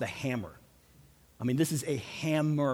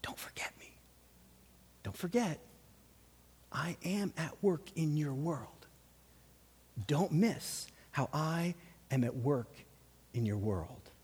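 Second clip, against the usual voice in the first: soft intimate whisper as God; 10 dB quieter than usual. Pretend. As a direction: breathe it gently into the soul. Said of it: "God just wants to whisper into".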